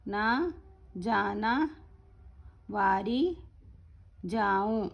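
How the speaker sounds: slow, word by word with pauses; teacher reading a poem slowly, word by word; slow recitation. Shown as a demonstration. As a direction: recite each word slowly for the class to repeat. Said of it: "slow slow पढ़ूंगी".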